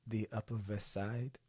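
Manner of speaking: soft imitation of an unidentifiable Carpathian accent; lightly mimicked Carpathian accent; Janos. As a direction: say it quietly in a made-up Carpathian accent. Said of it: "he developed that unidentifiable Carpathian accent".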